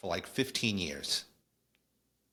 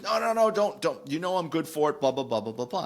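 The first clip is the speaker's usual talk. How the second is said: high, protesting Rob, brushing it off; mock-insistent no no no, much higher; Rob waving it off; 6 semitones higher than usual.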